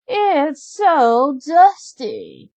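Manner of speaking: high, slow whiny stepsister; a spoiled stepsister piling on chores; high and drawn-out bratty sister. Acted. Stepsister voiced.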